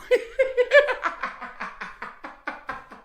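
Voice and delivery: loud, high burst of laughter; howling laugh; high cackling laugh. Real, not acted. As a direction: crack up at your own joke. Laughs at own joke.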